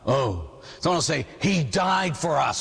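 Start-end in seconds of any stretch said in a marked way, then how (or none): 1.4-2.6 slow, drawn-out earnest answer. Retold or imitated. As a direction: imitate a kid answering solemnly. Kid's earnest answer before mock echo.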